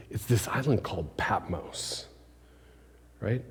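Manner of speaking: breathy hush about the remote island; hushed whisper. Acted. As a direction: whisper it like a secret.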